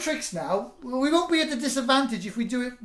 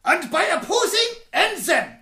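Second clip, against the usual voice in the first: put-on German accent; 7 semitones higher than usual.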